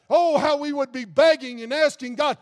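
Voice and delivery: ordinary talk, nothing marked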